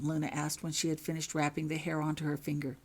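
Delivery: usual speaking voice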